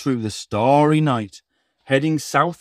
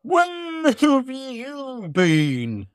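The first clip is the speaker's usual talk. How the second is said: Santa voice; 13 semitones higher, much slower than usual. Acted. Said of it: "asked Santa".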